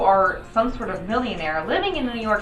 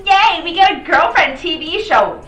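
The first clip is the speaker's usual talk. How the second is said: mocking cheer; 7 semitones higher, 6 dB louder than usual.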